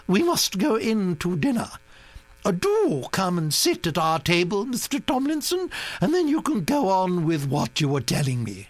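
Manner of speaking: high, commanding grand-lady voice; a bossy old aristocrat laying down plans; imperious old Duchess. A narrator's reading. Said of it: "the Duchess pronounced".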